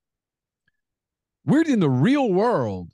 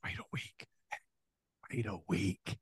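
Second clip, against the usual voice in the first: whispered, much softer, like a secret deal; conspiratorial whisper; 7 semitones lower, 14 dB quieter than usual. Pretend. Host mock-whispers a secret promise to Ukraine, conspiratorial whisper. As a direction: lean in and whisper the wink.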